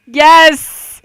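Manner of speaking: loud, high victory yell; excited cheer. Real, not acted. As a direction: shout it out in delight. Hoped-for answer is true.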